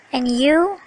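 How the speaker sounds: you lifted on a rising tune; interested rising and you; rising question. Shown as a demonstration. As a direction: let the voice climb on you to show interest. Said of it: "Notice the rising intonation on".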